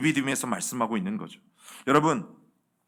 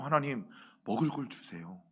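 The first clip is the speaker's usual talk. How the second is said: much softer, a humble request to God; softly acted prayer; 2 semitones lower, 10 dB quieter than usual. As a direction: plead quietly in prayer. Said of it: "어떻게 기도해야 합니까". (나직이 기도하듯)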